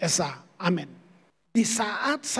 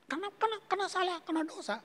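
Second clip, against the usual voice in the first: mocking himself; 9 semitones higher, 8 dB quieter, much faster than usual. Mocking his own harsh excuses.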